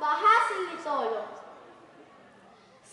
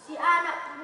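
usual speaking voice

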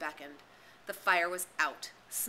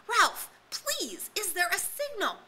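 high, loud desperate plea; pleading; 14 semitones higher, 7 dB louder, slower than usual. A narrator's reading. Piggy pleading with Ralph about the signal, desperate high plea. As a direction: beg him to answer.

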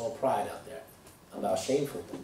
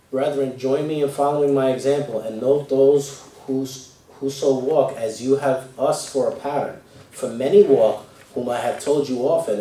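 louder, solemn Bible reading from the pulpit; scripture reading; 7 dB louder than usual. Acted. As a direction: proclaim the verses to the congregation. Reads Philippians aloud in sermon.

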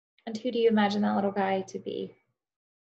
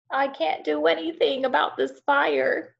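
higher, lightly croaked frog voice; a hint of a croaky frog; croaking frog; 7 semitones higher, 4 dB louder than usual. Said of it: "croaked Frog".

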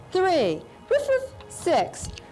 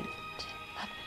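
soft whispered numbers; whisper counting; 18 dB quieter, faster than usual. Shown as a demonstration. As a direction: whisper them under the beat. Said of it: "Can you whisper count with me?".

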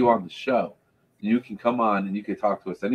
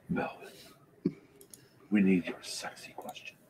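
seductive whisper; 9 dB quieter than usual. Acted. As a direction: breathe it seductively. Copies the teddy bear whisper.

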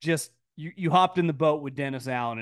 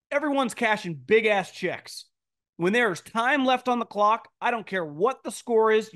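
ordinary talk

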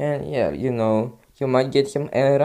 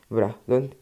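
ordinary delivery

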